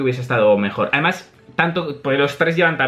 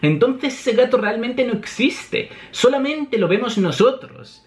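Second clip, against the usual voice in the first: higher-pitched, reasoning it out; voiced manga character; 8 semitones higher than usual. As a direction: act it out in a raised character voice. Acted line to Nami.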